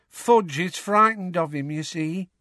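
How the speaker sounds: slow, a touch of sadness; sadly. A narrator's reading. Tonks explaining Fudge's fear to Harry, slow and mildly sad. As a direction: let it land slowly and sadly. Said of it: "said Tonks sadly".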